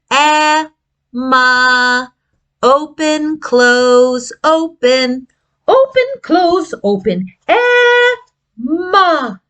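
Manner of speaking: "Emma" with open mouth and closed "m"; open-close mouth. Shown as a demonstration. Teacher shows the mouth shapes in Emma. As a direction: exaggerate the jaw open and shut. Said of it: "we take open".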